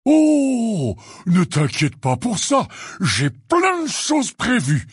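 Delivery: big, high, booming eagerness; enthusiastic. A narrator's reading. Teacher tells Hermione he has plans, enthusiastic. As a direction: reassure them with loud, bubbling enthusiasm. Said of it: "répondit Hagrid avec enthousiasme".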